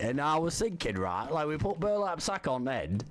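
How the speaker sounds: hammed-up British lad accent, lightly done; troll as a cheeky British bloke; fake British accent. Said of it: "I'm fucking talking like him".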